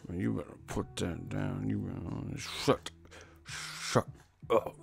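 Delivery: low-volume threat through pursed lips; puckered-mouth angry mom. Retold or imitated. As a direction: imitate mom scolding under her breath. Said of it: "Their mouth looks like an asshole".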